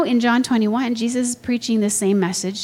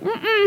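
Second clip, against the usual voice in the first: high-pitched "mm-mm" of refusal; short, high grunt saying no; refusing grunt; 13 semitones higher than usual. Acted. Peter balking at hearing the message, refusing high grunt.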